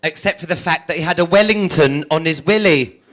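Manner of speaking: usual speaking voice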